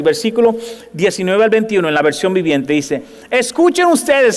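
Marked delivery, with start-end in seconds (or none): 3.3-4.4 slow, raised prophetic call; proclaiming. Acted. Jeremías proclama la palabra. (proclamación lenta y fuerte)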